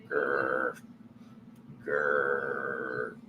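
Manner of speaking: soft playful annoyed growl. Shown as a demonstration. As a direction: growl lightly to let annoyance out. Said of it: "letting out that little growl".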